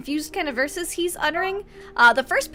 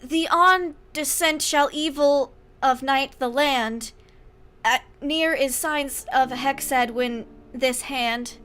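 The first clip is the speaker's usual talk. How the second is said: slow eerie recitation; 4 dB louder, slower than usual. Acted. Cryptic boy recites backwards verse.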